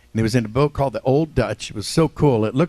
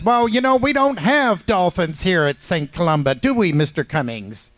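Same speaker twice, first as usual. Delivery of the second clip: nun's voice; 6 semitones higher than usual. Retold or imitated. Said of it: "And Sister Mary Agnes says".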